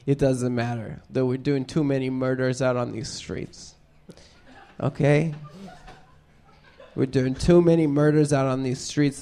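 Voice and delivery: grave mock sincerity about the streets; hushed solemn plea. Solemn quiet plea about murders.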